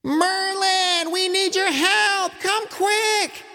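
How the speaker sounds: high, calling out to the woods; kids yelling. A narrator's reading. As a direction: shout for help across the yard. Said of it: "yelled into the forest".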